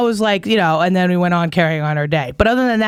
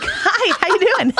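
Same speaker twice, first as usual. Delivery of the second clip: high, sugary mock-friendly hello; fake-sweet greeting; 12 semitones higher, slower than usual. Walking up to the vendor, a high fake-sweet hello. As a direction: sweeten it up before the ambush. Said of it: "I'm like".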